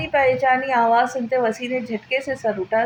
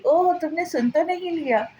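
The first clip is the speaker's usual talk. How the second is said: higher, mock-alarmed question; fake panic; 6 semitones higher than usual. A narrator's reading. Sara faking alarm after tricking her friend. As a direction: pretend to be caught out. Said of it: "सारा मसनूई घबराहट से बोली".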